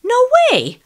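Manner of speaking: very high, very loud scream of refusal; shrieking no way; shouting. Acted. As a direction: scream it out at the screen. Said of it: "I shouted as I leapt".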